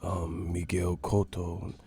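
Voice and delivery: put-on low, slow accented murmur; mock boxer speaking in third person, subdued; Miguel Cotto impression. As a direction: state it softly and haltingly. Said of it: "Cotto be talking in third person".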